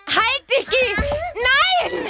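put-on high, sudden "Nein!" to stop a baby; sharp warning shout. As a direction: yell to stop him in his tracks. Stopping the crawling baby. (scharfer Warnruf)

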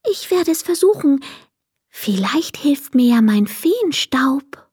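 high, small fairy voice; tiny fairy resolving to try; hopeful little fairy. A narrator's reading.

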